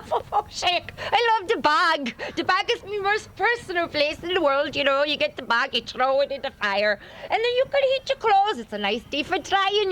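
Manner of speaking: thick Irish accent, high and lilting; broad Irish brogue, hammed up. Shown as a demonstration. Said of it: "did you pick up an Irish accent?".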